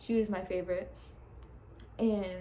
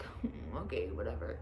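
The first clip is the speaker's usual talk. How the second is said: low, reluctant mumble of agreement; acted-out resigned acceptance; grudging; 10 semitones lower, 6 dB quieter than usual. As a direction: give in unwillingly, voice dropped right down. Reluctantly accepting the uncomfortable ball.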